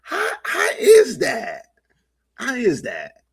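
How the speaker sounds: drawn-out high disbelief; incredulous. Real, not acted. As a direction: stretch it out, baffled and high. Host incredulous at co-host.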